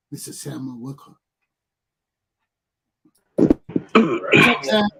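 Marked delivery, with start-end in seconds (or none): 3.3-4.9 big hearty hello, much louder; loud greeting. Real, not acted. Host calling to his correspondent on the line, loud hearty greeting. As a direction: call out loudly to the correspondent.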